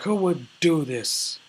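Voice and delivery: high, loud, slow outraged demand; retold raging. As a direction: play an angry boss demanding the culprit. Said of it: "was raging about all the wasted tomatoes".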